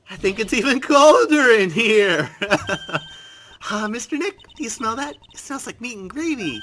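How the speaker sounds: put-on high, loud girlish voice, shivering; high excited girl voice in a cold hall; shivering Maya voice. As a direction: play Maya cold and chirpy. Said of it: "I'm not gonna do shivering Maya voice".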